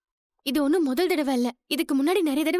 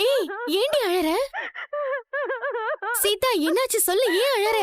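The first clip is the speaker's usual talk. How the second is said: higher, worried questioning; alarmed concern; 6 semitones higher than usual. Acted. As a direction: plead to know what is wrong. Alarmed at sister crying on phone. (பதற்றமான, கவலையான குரல்)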